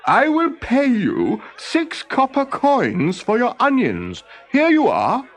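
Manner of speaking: high, slow, eager inventor voice. Acted. Olaf buying onions from Thornogson, high and eager. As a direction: coax him with a high sales pitch.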